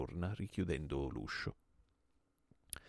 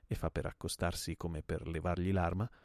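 ordinary delivery

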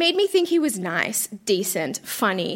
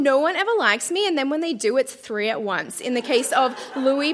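ordinary delivery

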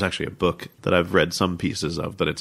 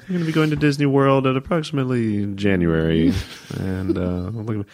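higher, louder cabin announcement delivery; airline pilot voice; 5 semitones higher, 3 dB louder than usual. Retold or imitated. Son imitates his dad announcing a trip, pilot voice. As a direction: announce a family trip like a flight. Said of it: "So he was just very like that".

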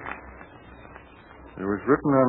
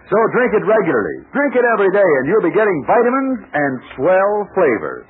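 upbeat radio commercial pitch; ad read; 4 semitones higher than usual. Acted. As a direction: sell it warmly to the kids. Sponsor ad read for children.

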